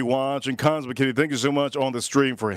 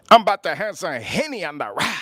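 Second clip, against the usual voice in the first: imitating viral guy; 3 semitones higher than usual. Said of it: "he goes".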